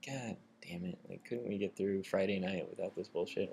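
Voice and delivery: soft, resigned grumble; fed-up muttering. Retold or imitated. Workers who had seen this before, resigned mutter. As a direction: imitate tired staff cursing under their breath.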